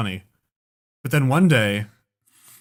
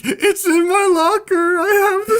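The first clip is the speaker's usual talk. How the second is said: very high, slow blubbering; hammed-up tearful wail; mock sobbing confession; 5 dB louder, slower than usual. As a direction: sob it out like a caught kid. Kid confesses through tears.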